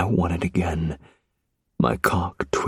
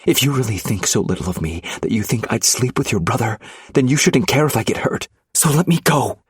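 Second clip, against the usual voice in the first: rising anger; 6 semitones higher, faster than usual. Acted. Wife demanding release, anger building to a shout. Viviana furious, struggling.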